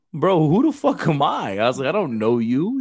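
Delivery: high, loud disbelief, retold; high-pitched mock incredulity; incredulous. Disbelieving reply to a stranger's answer.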